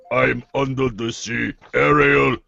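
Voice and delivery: mock Russian accent; Russian lobster. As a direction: parody your friend's accent as a Russian lobster. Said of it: "A Russian lobster".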